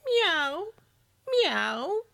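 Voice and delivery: high, rising meow like a thirsty cat; cat's cry. A narrator's reading. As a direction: mew out a high-pitched plea, rising at the end. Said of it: "drew up her head, and cried".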